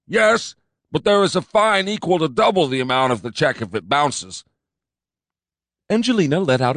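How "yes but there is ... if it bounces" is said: higher, louder pompous cop; fat cop insisting. A narrator's reading. Fat cop insisting to his partner, pompous.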